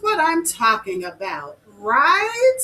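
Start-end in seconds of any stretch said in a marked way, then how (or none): none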